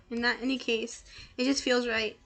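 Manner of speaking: ordinary talk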